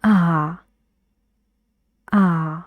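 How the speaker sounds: a dark آ from the back of the mouth. Shown as a demonstration. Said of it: "تغییر رو روی زبان هم ایجاد کنین".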